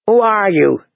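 mildly sharp demand. A narrator's reading. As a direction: demand an answer. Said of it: "she demanded".

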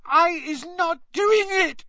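very high, terrified elf squeal; squealing Winky. A narrator's reading. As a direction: squeal the denial in terror. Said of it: "she squealed".

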